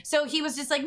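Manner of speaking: normal talking voice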